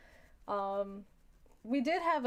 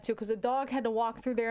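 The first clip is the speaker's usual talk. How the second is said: usual speaking voice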